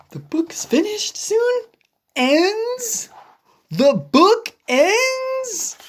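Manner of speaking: high, gasping worry; gasp, then ask in rising panic; alarmed. Acted. Elephant shocked the book ends.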